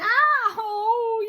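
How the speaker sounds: high loud ow; yelled. Acted. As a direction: yelp it out. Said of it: "yelled Wilbur".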